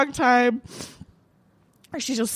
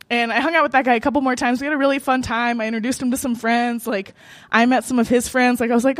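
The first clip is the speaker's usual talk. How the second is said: ordinary delivery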